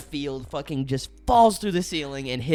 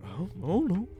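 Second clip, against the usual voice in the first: unsure; 5 semitones higher than usual. Retold or imitated. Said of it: "the only time he was certain".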